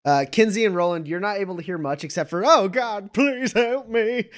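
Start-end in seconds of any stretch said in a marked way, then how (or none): 2.5-4.3 high, drawn-out cry for help; hammed-up panicked begging; desperate plea. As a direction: wail slowly for rescue. Captive's cries heard from other room.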